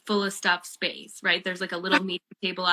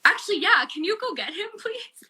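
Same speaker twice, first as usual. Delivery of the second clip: high, giggly change of mind; laughing request; 12 semitones higher than usual. Retold or imitated. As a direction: squeak it out, breaking into a laugh. Changes mind, asks to meet.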